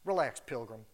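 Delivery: lightly voiced John Wayne, unbothered; John Wayne impression. Preacher retelling a horse thief calming the owner, lightly drawled. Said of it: "Channeling John Wayne".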